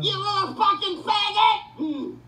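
furious shout. Real, not acted. Screaming at the swatters, furious yell. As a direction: scream it with full rage.

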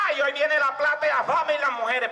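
high, excited crowing; elated anticipation. Acted. Teller sees people coming to reward him, high excited voice. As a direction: crow it as riches approach.

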